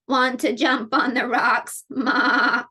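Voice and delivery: quavering, bleating goat talk. Acted. Goat invites spider onto the rocks, wavering bleat. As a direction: tremble every word like a bleat. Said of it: "said the goat".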